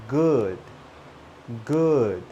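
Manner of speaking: GOOD with short relaxed "uh"-like oo, stretched slightly; GOOD with relaxed vowel. Shown as a demonstration. Teacher drilling a hard word, GOOD with loose lips. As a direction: open the mouth on GOOD. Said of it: "it goes up, down, and up".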